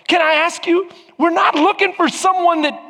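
usual speaking voice

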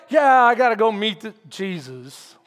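mock reluctance, sinking low; killjoy. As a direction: deflate as if meeting him is a chore. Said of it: "like Killjoy".

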